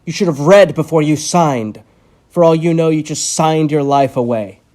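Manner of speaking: loud, cold threat; menacing anger. Acted. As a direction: lean over him and threaten. Doctor threatens trapped patient.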